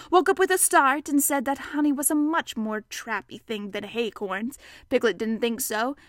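usual speaking voice

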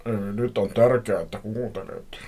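lightly put-on stranger's voice; a hint of a creepy caller; unknown man's voice. A narrator's reading. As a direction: retake the mysterious man on the phone. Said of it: "joku tuntematon miehen ääni".

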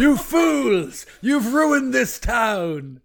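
loud, high ranting tyrant voice; booming villain monologue. Pretend. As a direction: proclaim it from the monument like a megalomaniac. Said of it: "Imagine you standing on the monument".

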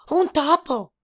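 high, loud mouse squeak; squeaky mouse. A narrator's reading. Mouse answering the boar, squeaky. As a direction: chirp it out as the mouse.

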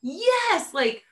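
high thrilled yes, yes; imagined excitement. Pretend. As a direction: cheer at her future self. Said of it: "look at my posts and be like".

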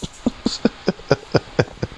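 high, soft trailing laugh. Real, not acted. Storyteller amused by his own outburst, laughing.

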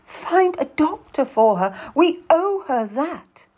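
high, emphatic appeal; insistent. A narrator's reading. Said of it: "Lucy insists".

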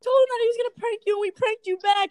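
high, rapid mock taunt; excited imitated gotcha; retold gloating reveal. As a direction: rattle it off high, as the triumphant prankster. Friend's triumphant prank reveal, retold.

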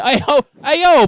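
high, loud hype reaction; hyped-up reaction. Retold or imitated. Friend reacting to Shaq's roast, high and loud.

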